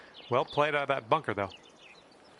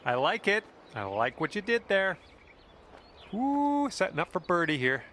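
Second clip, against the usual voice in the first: higher, bright praise for a good shot; excited; 6 semitones higher than usual. Real, not acted. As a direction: cheer the shot on, pitch lifted high.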